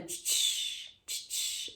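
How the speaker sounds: soft voiceless tch like air brakes; bus brakes tch. Shown as a demonstration. Teacher imitating a bus to show tch. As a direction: hiss tch like a bus stopping. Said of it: "como se fosse um ônibus parando".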